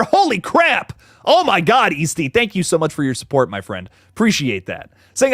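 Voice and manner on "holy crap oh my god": surprised delight. Real, not acted. Reacting to a year-long membership.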